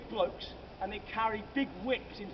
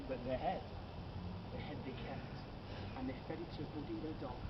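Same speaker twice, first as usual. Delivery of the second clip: lower, much softer dark aside; hushed grim reveal; 7 semitones lower, 11 dB quieter than usual. A narrator's reading. Guide telling the group the head fed to the dog, hushed. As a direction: drop the voice for the gruesome twist. Grim punchline dropped low.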